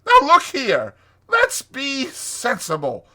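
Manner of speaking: high, loud, blustering Toad. Acted. Toad urges friends to help him, blustering. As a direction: insist boisterously at full voice.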